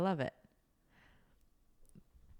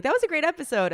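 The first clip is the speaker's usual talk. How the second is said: high, louder upbeat praise; imagined praise; 11 semitones higher, 6 dB louder than usual. Acted. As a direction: gush lightly as an impressed listener. Voices listener praising the episode.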